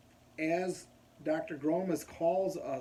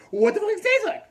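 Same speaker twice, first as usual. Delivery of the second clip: high-pitched, loud mock-incredulous yell; flipped-out nurse; 13 dB louder, faster than usual. Nurse asking about the taste of spinal fluid, flipped out. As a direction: imitate an outraged nurse demanding an answer. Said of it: "flips out".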